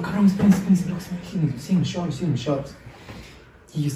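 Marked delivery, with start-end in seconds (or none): 0.0-2.8 urgent hushed warning. Retold or imitated. Telling friends to wait while robbers are near, hushed. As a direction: hiss at them to wait.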